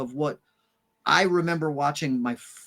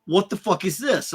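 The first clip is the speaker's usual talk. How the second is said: a touch of a baffled dad, raised; dad's reaction; 4 semitones higher, 3 dB louder than usual. Retold or imitated. Dad reading the school note, mildly incredulous. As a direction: read the note and demand an answer. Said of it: "My dad looks at me and goes".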